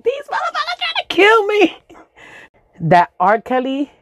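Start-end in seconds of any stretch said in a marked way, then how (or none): 0.0-1.8 put-on high squeaky kid outrage; panicked kid. Crying kid held down by parents, high squeaky protest. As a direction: whine it way up high. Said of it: "I was just like".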